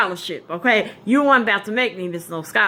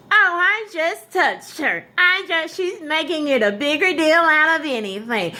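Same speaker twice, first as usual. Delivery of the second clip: high, dismissive teacher excuse; mock teacher, far higher; 9 semitones higher than usual. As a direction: play the teacher brushing it off, pitched way up. Teacher excusing herself, mocked.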